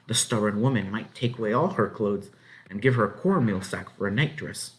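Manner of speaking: plain reading voice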